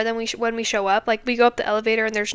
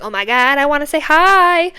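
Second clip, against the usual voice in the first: eager dog, pitched up and louder; excited dog voice; 4 semitones higher, 6 dB louder than usual. Retold or imitated. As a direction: play the overjoyed dog who must greet everyone.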